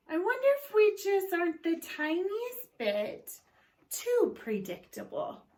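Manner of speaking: lightly voiced wife, musing; wife's voice. Wife musing to husband, lightly voiced. Mrs Watson voiced in read-aloud.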